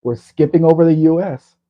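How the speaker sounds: loud, high call over a great distance. Real, not acted. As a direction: project it as if talking to another country. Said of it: "I'm talking all the way to Canada".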